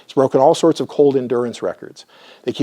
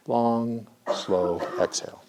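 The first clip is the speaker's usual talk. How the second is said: calm slow exhale; 8 dB quieter, slower than usual. Shown as a demonstration. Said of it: "rest and digest breathing".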